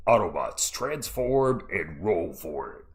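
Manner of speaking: deep, slow robot voice; low booming robot leader; Optimus Prime. Retold or imitated. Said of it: "I sound like Optimus Prime".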